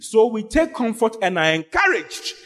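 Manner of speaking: fervent sermon proclamation; preaching. Acted. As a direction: declare it boldly to the church. Preacher leads a bold declaration.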